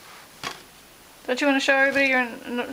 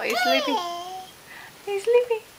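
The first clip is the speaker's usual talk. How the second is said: sing-song; 6 semitones higher, 4 dB quieter than usual. Pretend. Pretending baby is asleep.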